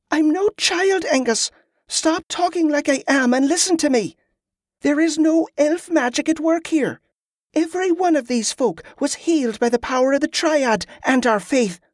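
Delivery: put-on high-pitched, insistent girl's voice; defiant young Molly. A narrator's reading. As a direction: voice an indignant child far above your own pitch.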